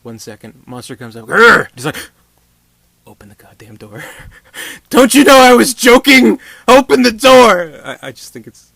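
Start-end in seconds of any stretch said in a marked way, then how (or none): 1.3-1.7 loud, high mock monster growl. Monster comes up. 3.1-4.0 tense hush. Retold or imitated. Doctor panics quietly. 4.1-4.8 a quick unvoiced panicked laugh; breathy nervous laugh. Nervous laugh before screaming. 4.9-6.4 much higher, screaming loud; panicked scream. Retold or imitated. 6.7-7.7 "Open the door" screamed, much higher and louder, slow; panicked scream. Retold or imitated.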